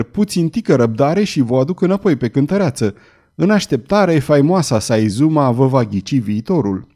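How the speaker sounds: ordinary delivery, nothing marked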